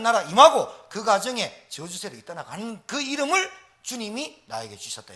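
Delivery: loud, rising sermon climax; full-force preaching. Acted. Pastor urges the congregation to preach, loud fervent preaching. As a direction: drive the message home to the pews.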